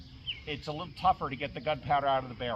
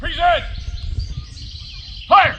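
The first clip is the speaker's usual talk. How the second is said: shouted command; 12 semitones higher, 12 dB louder than usual. Acted. Drill commands to firing line.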